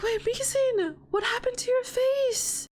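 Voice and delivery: put-on high-pitched worried girlfriend voice; alarmed Scarlet. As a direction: pitch it right up in concern. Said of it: "She's like".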